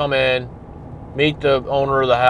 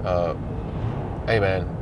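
nice and friendly; 6 semitones lower, 6 dB quieter than usual. Retold or imitated. Officer offering the drunk guest a ride home, gentle and low. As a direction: lower and softer, easygoing and kind. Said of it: "if you engage nicely".

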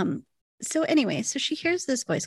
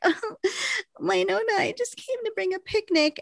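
much higher, sugary mock-innocent voice; fake innocent; 11 semitones higher than usual. Jolene caught, fakes innocence to Wynonna in a high sweet voice. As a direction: play it sweet to hide the guilt. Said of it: "and Jolene's all like".

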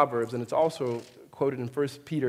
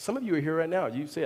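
ordinary talk, nothing marked

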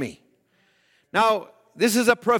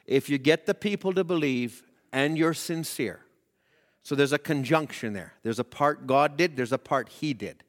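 much softer and lower, confiding; hushed aside; 6 semitones lower, 5 dB quieter than usual. Acted. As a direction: draw them in with a quiet, lowered voice.

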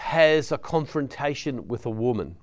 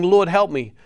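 loud, raised plea; pleading cry; 6 semitones higher, 6 dB louder than usual. Acted. Kneeling woman begs Jesus for help, a loud plea. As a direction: beg him out loud.

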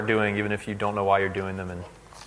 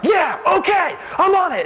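eager yes; 6 dB louder than usual. Retold or imitated. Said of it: "she's like".

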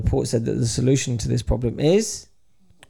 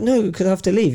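higher-pitched mock protest; insist, pitched up; retold insistent refusal; 7 semitones higher than usual. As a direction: imitate a man standing his ground.

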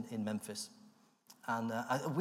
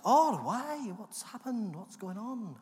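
Michael surprised; 9 semitones higher, 4 dB louder, slower than usual. Retold or imitated. Michael voiced on phone.

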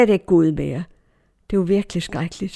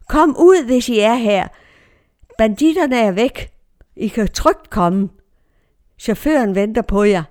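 retold calling out; 2 semitones higher, 5 dB louder than usual. Man calls the hidden passengers out, retold shout. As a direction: call out to people in hiding. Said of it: "Han råbte".

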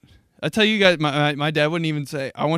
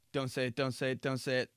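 urgent self-talk; 2 semitones lower, 10 dB quieter, faster than usual. Acted. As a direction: chant it softly to yourself, holding back. Coaching himself not to order.

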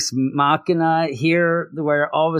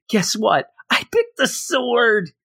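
higher, slowed, proud Brian; mock Brian voice; 8 semitones higher, slower than usual. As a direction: drag out the boast like a kid. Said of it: "he ends up saying".

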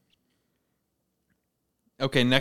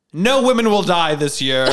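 louder, higher declaration; pitched-up playful decree; mock proclamation; 4 semitones higher, 6 dB louder than usual. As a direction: announce it as a grand comic prediction.

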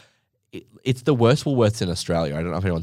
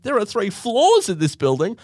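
mock awestruck dad, pitched up high with a rising end; amazed dad; 11 semitones higher, 3 dB louder than usual. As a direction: gush over three floors like a wonder of the world.

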